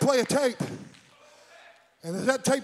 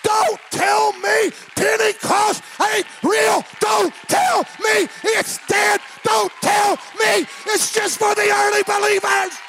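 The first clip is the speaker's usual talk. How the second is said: high, loud, slow hammered shout; full-force preaching; 9 semitones higher, 10 dB louder than usual. Real, not acted. Preacher defending Pentecost to the church, fervent drawn-out shouting. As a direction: punch out every word to the congregation.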